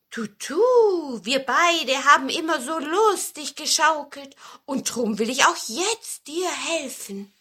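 lightly voiced wind with a hooting start; friendly wind. A narrator's reading. Wind speaks kindly to the cone. (leicht gespielter, freundlicher Wind)

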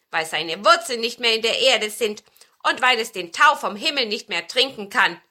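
laut berichtender Besen, leicht gespielt; erzähl den Zwergen eindringlich vom Blümchen; eindringlicher alter Besen. A narrator's reading. Spielt den alten Besen. (leicht gespielte, kräftige Besenstimme)

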